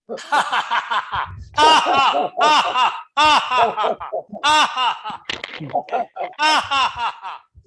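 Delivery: big high belly laugh from the gut; hearty booming laugh; loud demo laugh. Shown as a demonstration.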